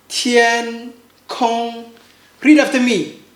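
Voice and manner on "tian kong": TIAN KONG, slow flat syllables; first tone. Shown as a demonstration. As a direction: hold tian and kong level, each drawn out.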